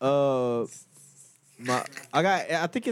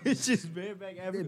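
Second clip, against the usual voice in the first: laughing; 6 dB quieter than usual. Real, not acted. Cracking up over the phrase.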